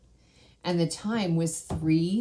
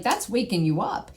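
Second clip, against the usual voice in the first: normal talking voice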